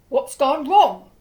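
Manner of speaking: loud, anxious duke asking; worried loud question. A narrator's reading. Duke asks the narrator what went wrong, loudly. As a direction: call out in alarm.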